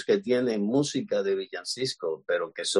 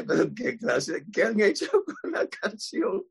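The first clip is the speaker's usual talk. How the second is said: much higher, laughing in disbelief; 10 semitones higher than usual. Retold or imitated. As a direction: laugh at what they did to the song. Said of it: "y yo dije".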